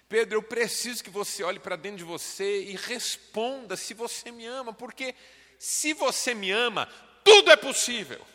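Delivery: high, loud, fast full-force preaching; shouted preaching climax. Acted. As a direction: cry it out over the music like an altar call. Climactic altar call, shouted. (pregação no auge, gritada e aguda)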